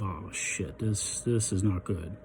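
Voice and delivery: quiet, dropped voice, saying it to himself; barely voiced private dread; muttered thought. Thinking to himself as his date mentions her ex, muttered. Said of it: "And I'm thinking to myself".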